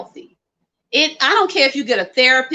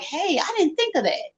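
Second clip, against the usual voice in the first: surprised realisation; 8 semitones higher, 6 dB quieter than usual. Retold or imitated. Voicing a sudden realisation.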